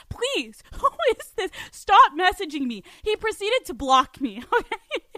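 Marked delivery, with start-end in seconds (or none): 0.1-2.8 higher, louder mock plea from a scared stranger; pleading man. Stranger begging her to stop messaging, higher mock plea. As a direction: beg him to stop, voice pitched up. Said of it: "this man is genuinely like".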